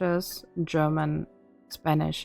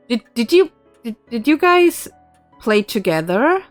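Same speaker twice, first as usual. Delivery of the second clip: excited; 5 semitones higher, 7 dB louder than usual. Real, not acted. Excited question.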